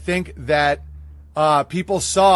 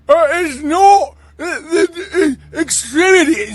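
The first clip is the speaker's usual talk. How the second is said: much higher and louder, halting, strained syllables; mocking strained speech; 11 semitones higher, 6 dB louder than usual. Host mocks a speech-impaired person, strained and halting. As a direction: strain and stall on each sound like a mock impression. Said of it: "He was Helen Keller two point oh".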